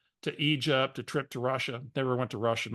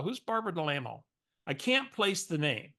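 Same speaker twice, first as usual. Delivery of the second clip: ordinary delivery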